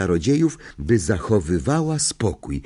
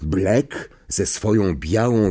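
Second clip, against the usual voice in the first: plain reading voice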